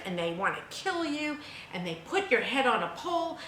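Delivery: ordinary talk, nothing marked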